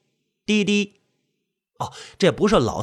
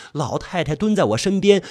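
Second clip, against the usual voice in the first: ordinary delivery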